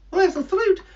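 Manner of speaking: high, eager bird squeak; greedy bird puppet hearing fruit; excited puppet bird. Acted.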